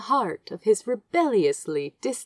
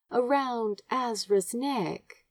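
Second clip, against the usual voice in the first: plain reading voice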